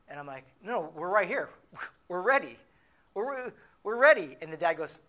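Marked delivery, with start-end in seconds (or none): 0.6-4.4 loud, rising insistence; frantic protest. Retold or imitated. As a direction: plead your case louder and higher. Protesting the default to the dad.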